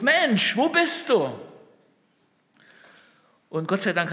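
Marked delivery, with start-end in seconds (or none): 0.0-1.3 high, loud, ringing call. Acted. Preacher voices God calling mankind, calling out. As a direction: call out to all humanity. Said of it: "Gott ruft".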